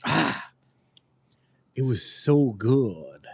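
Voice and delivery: normal talking voice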